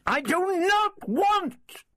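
very high, drawn-out angry cry; high-pitched, blazing refusal; flaming outburst. A narrator's reading. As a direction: cut him off with a high, slow, furious protest. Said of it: "she flamed".

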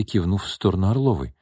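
ordinary delivery

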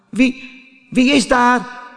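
high, trembling stutter; frightened stammer. Acted. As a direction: stammer it out in fear. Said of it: "stotterde Pinocchio, want hij trilde van angst". (bang stotterend en hoog)

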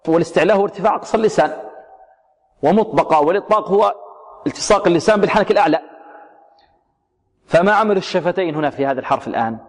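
ordinary delivery

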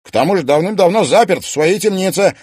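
fast, loud panicked denial; panicked protest. Acted. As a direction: rattle it out in panic.

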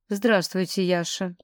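ordinary delivery, nothing marked

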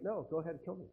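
low, soft, serene acceptance; softly resigned to death, unafraid; calm unafraid. Acted. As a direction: say it with quiet peace, no fear. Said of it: "But they're like".

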